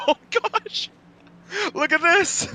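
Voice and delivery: astonished commentator; shocked. Real, not acted. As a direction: gasp at the crash. Wild crash on track.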